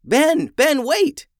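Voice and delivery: high, loud urgent call; shouting. A narrator's reading. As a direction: shout to stop him. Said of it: "Emmeline shouted as she approached".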